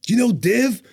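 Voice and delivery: high, loud retold question; mock challenging shout. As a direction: imitate a loud grilling of strangers. Phelps quizzing them, retold.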